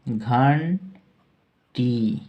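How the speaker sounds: घंटी drawn out very slowly, syllable by syllable; teacher stretching out घंटी; slow dictation. Shown as a demonstration. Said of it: "bell का अर्थ है".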